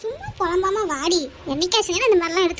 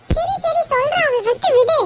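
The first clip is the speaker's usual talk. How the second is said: high, urgent yelp of giving in; squealing plea to be released; pained surrender; 10 semitones higher, 4 dB louder than usual. Acted. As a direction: give in quickly to stop the pain. Gives in while painfully held.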